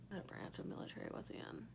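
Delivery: unvoiced whisper, asking herself. Real, not acted. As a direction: mutter the question under your breath. Unsure about her dad's branch.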